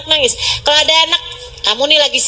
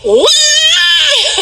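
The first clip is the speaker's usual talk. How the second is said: sudden high imitated bawl; serious child's wail; 14 semitones higher than usual. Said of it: "begitu anak nangisnya serius".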